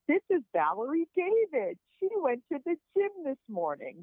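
high, excited mock announcement; amazed announcement. Doctor to everyone in the surgical room, amazed announcement. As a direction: present her to the room with high-pitched amazement.

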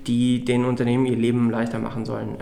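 normal talking voice

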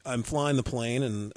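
normal talking voice

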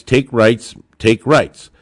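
ordinary talk

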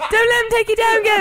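very high, loud, fast Cockney squeal; Cockney sidekick. Acted. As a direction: egg the boss on shrilly in Cockney. Sidekick cheers on boss.

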